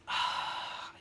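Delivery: soft, shaky breath out; a real overcome sigh, almost silent; sighs, too moved to speak; emotional sigh. Real, not acted. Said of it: "I still get emotional whenever I talk about this".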